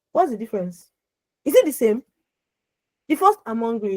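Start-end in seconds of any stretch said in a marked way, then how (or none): 3.4-4.0 soft, understated remark; just let someone know, softly; mild wishful telling. Shown as a demonstration. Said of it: "I'm telling someone I'm, I'm hungry".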